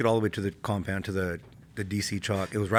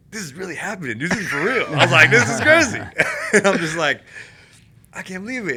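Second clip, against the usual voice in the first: much higher and louder, laughing in disbelief; retelling laughing giddily while pinned down by mortars; excited disbelief; 10 semitones higher, 10 dB louder than usual. Retold or imitated. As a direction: blurt it out giddy, half laughing under mortar fire.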